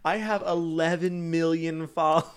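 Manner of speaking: puffed-up imitation of online bragging; mock bragging. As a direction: crow it like a big milestone. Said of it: "they're like".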